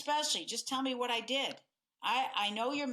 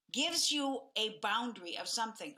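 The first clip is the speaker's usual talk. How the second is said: ordinary delivery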